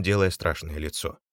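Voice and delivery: usual speaking voice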